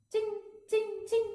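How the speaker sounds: high, bright clink-clink of glasses; clinking laughter. A narrator's reading. Wine glasses clinking with mocking laughter. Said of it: "τσούγκρισαν εκείνα τα γέλια".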